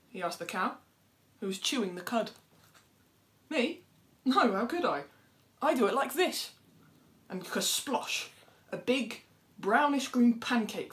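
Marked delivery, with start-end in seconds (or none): 3.5-6.5 high, loud, prim denial; a smug little bird protesting her innocence; innocent animal voice. Acted. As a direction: play the dove, shocked at the idea. Cow denies it, shows how.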